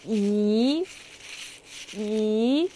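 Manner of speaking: 宜 rising in pitch; rising second tone. Shown as a demonstration. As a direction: lift 宜 upward. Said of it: "this is the second tone".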